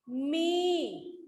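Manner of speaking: "मी" with the ee held long; long ee vowel. Shown as a demonstration. Teacher modelling the matra for students, long-drawn "मी". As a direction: stretch out the vowel of "मी". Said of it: "लंबा होता है ना".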